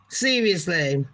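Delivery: normal talking voice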